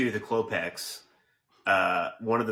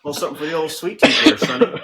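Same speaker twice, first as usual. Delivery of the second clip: fast, bright and high on Sonny; cheery old man; 3 semitones higher, 5 dB louder, faster than usual. Retold or imitated. Quoting the neighbour offering brownies, fast folksy cheer. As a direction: toss off a folksy offer to a kid.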